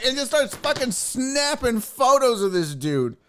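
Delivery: ordinary talk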